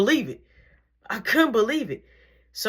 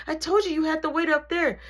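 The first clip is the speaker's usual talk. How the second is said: exasperated scolding; 9 semitones higher, faster than usual. Retold or imitated. Assistant turns round to scold.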